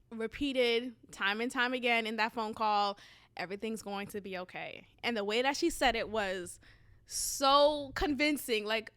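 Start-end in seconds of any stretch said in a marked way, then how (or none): 3.4-4.9 much softer, soothing; calm reassurance. Retold or imitated. Cousin repeating on the phone, soft and soothing. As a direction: hush it, reassure gently. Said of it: "She spoke to me very calmly".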